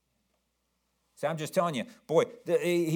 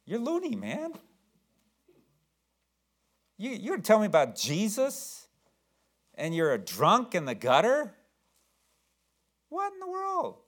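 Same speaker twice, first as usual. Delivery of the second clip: incredulous; 7 semitones higher than usual. Retold or imitated. Listener reacts to drunk preacher.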